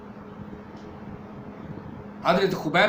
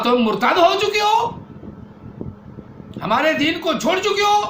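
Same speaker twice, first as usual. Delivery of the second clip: angry accusation; 9 semitones higher than usual. Acted. Umar accuses sister of apostasy.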